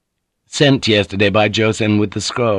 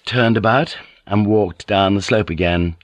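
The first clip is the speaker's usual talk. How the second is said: ordinary delivery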